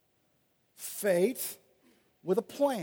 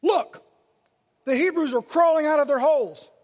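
higher, louder jeering call; taunting shout; 5 semitones higher, 6 dB louder than usual. Acted. Philistines on the outpost jeering at the Hebrews, loud taunting shout. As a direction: yell it down the cliff as a taunt. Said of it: "they shouted".

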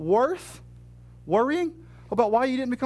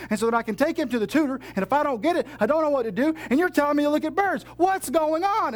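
exasperated complainer; 5 semitones higher than usual. Acted. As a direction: rattle off your troubles in a rising panic. Congregant voiced complaining to God.

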